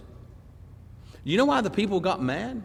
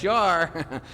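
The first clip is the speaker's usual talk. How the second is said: ordinary talk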